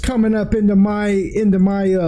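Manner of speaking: high, far-off hailing voice of a wolf lord; Trask calling out. Acted. Wolf lord hailing the party from behind, high calling voice. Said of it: "he calls out to you all and he goes".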